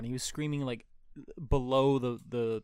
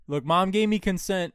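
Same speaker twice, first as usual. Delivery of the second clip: loud, raised announcement; 6 semitones higher, 7 dB louder than usual. Retold or imitated. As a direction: say it loudly so everyone hears. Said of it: "I say that very loud".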